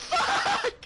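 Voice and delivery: shrieking laugh. Real, not acted. Gamer bursts out laughing.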